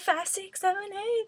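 counting in the dancers, rising; dance teacher count. Pretend. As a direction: call the eight-count like a teacher.

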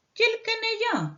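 squeaky mouse. Acted. Mouse refuses to carry grain, squeaky high cry. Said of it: "закричав Круть".

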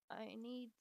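ordinary delivery

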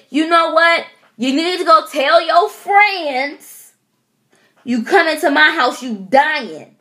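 high, loud threatening rant; tweaking out. Retold or imitated. Warning the bug to tell its friends, high and raging. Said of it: "I tweaked".

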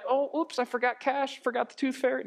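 high, light oops voice; mock-innocent excuse. Husband excusing his forgetfulness, mock innocent. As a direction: toss off a harmless little excuse.

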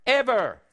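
ever punched high and loud; emphatic ever. Real, not acted. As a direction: slam the last word. Sermon emphasis.

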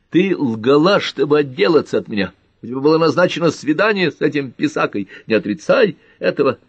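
loud, high jealous tirade; heated, indignant outburst; angry accusation. A narrator's reading. Carter angrily accuses Pat of lying.

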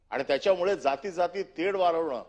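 ordinary talk

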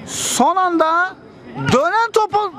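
high, slow drawn-out call; excited commentator. Real, not acted. Live play call.

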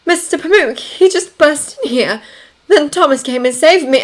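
high, distressed account; trembling voice. A narrator's reading. Daughter tells father of the intruder, voice trembling. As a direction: tremble through a high-pitched explanation. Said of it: "her voice trembling".

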